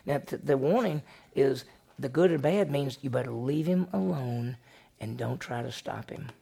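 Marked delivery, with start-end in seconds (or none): none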